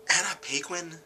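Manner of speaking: high-pitched questioning of the name; incredulous. Real, not acted. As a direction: query the name in disbelief. Repeats disbelief at the actress.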